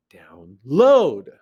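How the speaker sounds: exaggerated LOAD in Download; stress on second syllable. Shown as a demonstration. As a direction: lean hard on LOAD after a low down. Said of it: "unstressed, stress".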